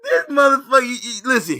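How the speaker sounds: cracking up through the words; laughing disbelief. Real, not acted. Host laughing at the guest's clip, words breaking into laughter.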